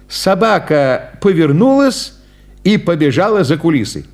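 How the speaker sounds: usual speaking voice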